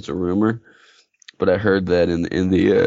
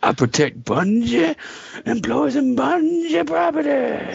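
high, drawn-out mock battle cry; put-on character voice; slower than usual. Joking about an endless fight, put-on high hero voice. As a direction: stretch it out high, like a silly hero.

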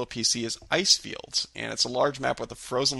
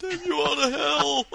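hammy dramatic curse; 13 semitones higher than usual. Pretend.

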